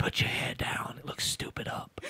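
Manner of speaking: unvoiced comic whisper; mock Whisperer. Co-host playing a Whisperer mocking a hairdo, whispering. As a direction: whisper catty advice for laughs. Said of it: "maybe that's why one of them said".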